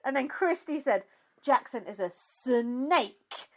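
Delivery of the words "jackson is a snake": "snake" drawn out slowly as "suh-NAKE"; snake in two syllables. Retold or imitated. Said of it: "she's put two syllables in snake".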